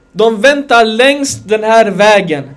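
väntar drawn out slow and loud; slow emphatic sermon line with väntar stretched; slow stressed phrase. Real, not acted. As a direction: spell out väntar längs, slowly and loud. Preacher stressing along the road.

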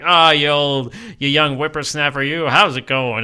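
a hint of an old prospector, loud; lightly voiced codger, hammed-up greeting. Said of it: "He's laying it on thick".

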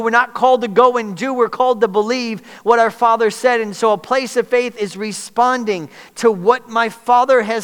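loud, high, driving sermon delivery; full-force preaching. Acted. Preacher pressing the church to keep believing, full-force preaching. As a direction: preach it hard to the congregation.

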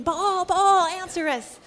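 high, loud frantic cry of Baal's prophets; calling out to Baal. Retold or imitated. Prophets crying out to Baal, loud and frantic. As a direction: cry out to the idol, desperate.

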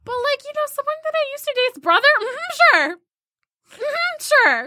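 high, sing-song mock "Mm-hmm, sure"; a sarcastic high-pitched "sure"; high mock sarcasm. Mocking Tinder self-talk.

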